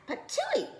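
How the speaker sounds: very high laughing spit sound; spitting out. A narrator's reading. Goldilocks spitting out hot porridge, high laughing splutter. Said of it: "and she spat it out".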